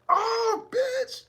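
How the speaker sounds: very high squealed cry; shrieking mock pain. Victim losing a fingernail, high mock shriek. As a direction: shriek as the nail comes off. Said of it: "They like".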